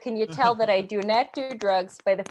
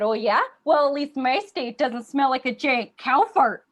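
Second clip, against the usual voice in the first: louder, indignant sibling retort; Minnesota; 3 semitones higher, 5 dB louder than usual. Acted. Minnesota firing back at little sister Wisconsin, loud mock-indignant retort. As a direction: hit back with a loud playground insult. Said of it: "And Minnesota said".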